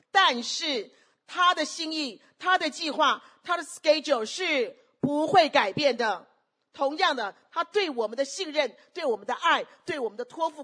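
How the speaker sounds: loud, high, emphatic sermon declaration; full-force preaching. Real, not acted. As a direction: hammer out each phrase to the congregation. Preacher declares God's unchanging plan.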